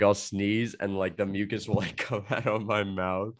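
ordinary delivery

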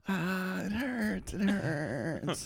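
put-on high, soft, pained little-kid voice; whiny hurt kid. Hit girl complaining to him, high soft whimper. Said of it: "And she's like".

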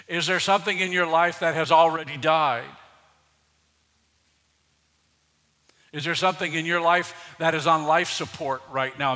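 loud, pressing questions; fervent preaching. Acted. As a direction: urge the congregation to answer. Urgent altar appeal.

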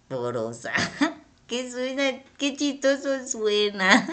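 "Porosa" said through a low giggle; amused and laughing at how it sounds. Real, not acted. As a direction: giggle out the silly word. Laughs at the funny word.

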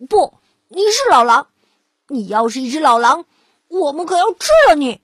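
loud, fast pig threat; threatening pigs. Acted. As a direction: menace the wolf with quick loud words.

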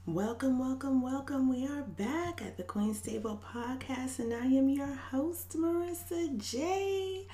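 high, drawn-out welcome; podcast host intro. Real, not acted. Host welcomes listeners back to her podcast, high singsong announcer. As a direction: sing out the show opening on a high pitch.

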